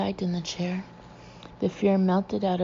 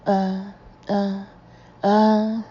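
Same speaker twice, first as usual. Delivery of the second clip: rising terrified cry, getting louder; pathetic cry; 7 dB louder, much slower than usual. A narrator's reading. Ginger under trance, crying out in terror. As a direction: let a tortured cry break out. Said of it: "A pathetic cry escaped her".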